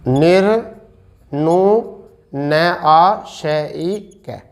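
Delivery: निर्नु then न आ श ई क spelt out very slowly; teacher slowly dictating निर्नुनासिक for students; syllable-by-syllable spelling. Shown as a demonstration. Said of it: "निर्नुनासिक".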